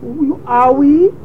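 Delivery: put-on very high, drawn-out baby talk; pretend loving father. Pretending loving father for the tape, exaggerated cooing. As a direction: coo soothing nonsense to a hurt toddler. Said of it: "pretend like I'm the loving father".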